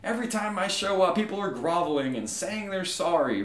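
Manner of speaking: high-pitched griping deity; exasperated God. Acted. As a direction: complain like a God sick of apologies. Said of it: "he says".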